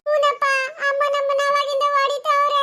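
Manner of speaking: whining words ending in sobs; tearful complaint. Acted. Child backs up sibling to father, tearful complaint. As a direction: complain through acted tears.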